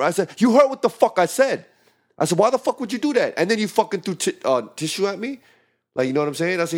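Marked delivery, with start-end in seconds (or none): 0.4-1.6 loud, high-pitched angry retort; raised voice, retelling an angry comeback; losing temper. Retold or imitated. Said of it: "I did lose my temper". 2.4-5.4 high-pitched, indignant confrontation; heated retold demand, pitched up; losing temper. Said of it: "I did lose my temper".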